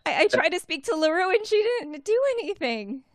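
high, loud squeal of a tiny person; loud, high, excited little girl voice; squeaky Winley voice. Acted. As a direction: call out to Izzy in a tiny shrill pitch. Winley voice continues.

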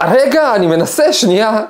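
high, loud, slow frantic call; yelling back to the man inside the toilet; retold yell. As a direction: shout back while wrestling the door. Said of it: "ואני צועק לו".

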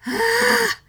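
a high, sharp "ah" of alarm; panicked yelp. Retold or imitated. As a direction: yelp as if caught off guard. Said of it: "And I'm like".

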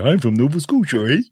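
Yogi Bear. Acted. Host jokes about Nova Scotia, cartoon Canadian accent. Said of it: "Like Yogi Bear".

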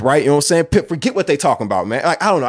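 ordinary talk

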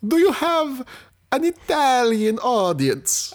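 put-on very high, slow hammy Italian accent; mock Italian accent. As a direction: lean hard into a cartoon Italian. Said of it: "accents that alienate audiences".

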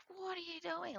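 high-pitched mock grumble; imitating a brother's annoyed protest; grousing. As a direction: complain at being jumped on. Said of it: "Like, frousing on me 'cause I jumped".

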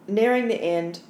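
ordinary delivery

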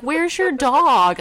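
high, slow drawn-out question; imitating the neighbor. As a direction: stretch the question out. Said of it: "He'd be like".

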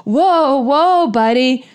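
slow the pony down, nervously; slow, anxious coaxing of a horse; nervous whoa. Retold or imitated. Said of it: "it's just like".

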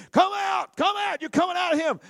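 high, loud command to cast out; commanding shout. Retold or imitated. Jesus to the demons, a loud commanding shout. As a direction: order the demons out with full force.

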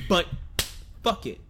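ordinary talk

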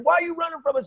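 high, frantic interrogation; mock cop yelling without real volume; imitated police demand. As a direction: demand it urgently, pitch way up. Imitates police roughing up kid.

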